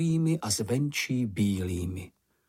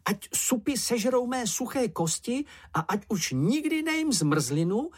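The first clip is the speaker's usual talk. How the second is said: very high-pitched, excitable babble; jabbered oath; 12 semitones higher than usual. A narrator's reading. Čenich swears to keep the secret, high jabbering voice. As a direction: gabble a solemn oath in a small high voice. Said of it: "zabrebentil Čenich".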